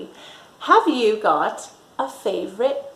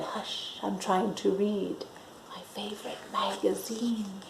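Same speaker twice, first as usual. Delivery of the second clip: soft, hushed shush; shushing mum; 12 dB quieter than usual. Acted. Mum hushing daughter while reading, very soft. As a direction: hush the child in a near whisper. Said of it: "But Mum said".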